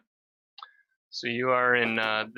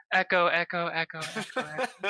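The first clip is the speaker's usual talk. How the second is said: raised voices echoing, fading; chorus of echoes; 7 semitones higher than usual. Acted. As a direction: chant it like many distant voices. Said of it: "they all go".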